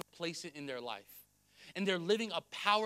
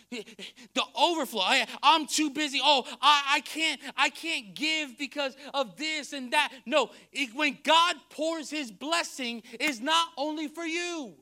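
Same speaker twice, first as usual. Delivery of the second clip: raised, louder preacher's voice; forceful preaching; 6 semitones higher, 9 dB louder than usual. Real, not acted. Preacher arguing against church excuses, forceful preaching.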